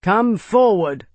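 slow, firm command; sentry commanding strangers to approach; demanding. Acted. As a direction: order them forward slowly. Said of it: "Schultz demanded".

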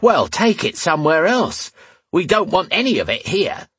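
high, indignant woman's outburst; angry high-voiced barmaid. A narrator's reading. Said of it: "as she raised her voice".

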